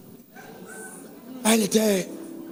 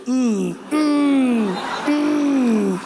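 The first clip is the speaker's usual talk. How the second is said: mock warning hum; 4 semitones higher, much slower than usual. Imitating wife's warning hum.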